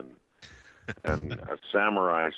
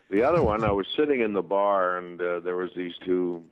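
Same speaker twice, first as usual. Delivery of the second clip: ordinary delivery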